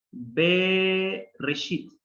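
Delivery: "Be Reshit" drawn out, each syllable clear; slow Hebrew word. Shown as a demonstration. Teacher modelling "Be Reshit" slowly for students.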